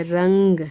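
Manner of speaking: रंग said loudly with the nasal ं held; nasal anusvara. Shown as a demonstration. Teacher showing pupils how anusvara is read through the nose, रंग loud and nasal. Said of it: "नाक से पढ़ेंगे".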